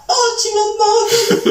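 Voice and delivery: high, gushing movie-heroine voice; sappy romance line. Acted. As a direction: overplay it like a cheesy Christmas film.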